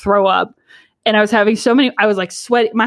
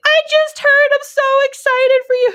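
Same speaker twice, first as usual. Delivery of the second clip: very high, imitated excited squeal; gushing congratulations; 3 dB louder than usual. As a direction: gush like an overexcited well-wisher, pitched way up. Imitating gushing volunteers congratulating her.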